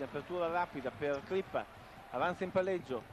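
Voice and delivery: usual speaking voice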